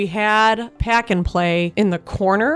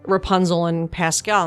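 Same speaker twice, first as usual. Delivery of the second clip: ordinary delivery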